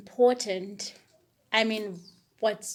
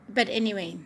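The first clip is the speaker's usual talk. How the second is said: ordinary talk, nothing marked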